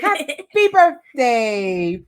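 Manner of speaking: loud, slow, drawn-out joyful cry; joyful exclaim. Acted. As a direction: burst out in delight. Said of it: "Frosty exclaimed once again".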